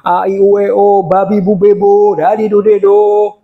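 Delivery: sustained, chanted syllable drill; vocal warm-up drill. Shown as a demonstration. Speaker demonstrates pre-sermon warm-up, chanted syllables. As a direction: run the vowels together evenly on one note. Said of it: "itu pemanasan dulu".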